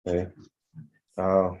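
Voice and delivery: ordinary delivery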